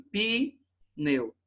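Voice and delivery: "Pneu" split into pi, pause, neu; separação errada. Shown as a demonstration. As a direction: break "Pneu" after a drawn-out first sound. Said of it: "Não posso separar assim". ("Pneu" separado com pausa: pi... neu)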